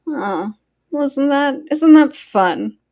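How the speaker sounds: loud, slow mock delight; sarcastic. Real, not acted. Streamer mocking the wife's congratulations, sarcastic. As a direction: drip with sarcasm.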